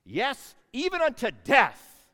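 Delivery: loud, slow defiant shout; furious, drawn-out cry; angry Jonah. Acted. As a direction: declare it furiously, dragging it out. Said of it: "this is what Jonah says".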